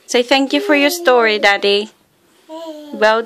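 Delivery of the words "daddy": ordinary talk, nothing marked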